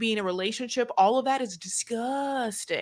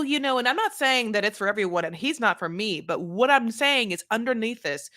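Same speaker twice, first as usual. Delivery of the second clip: ordinary delivery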